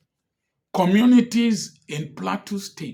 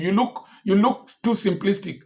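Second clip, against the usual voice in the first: ordinary talk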